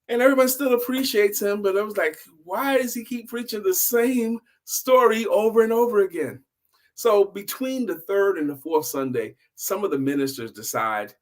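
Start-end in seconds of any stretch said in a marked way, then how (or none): 0.1-6.4 exasperated question. A narrator's reading.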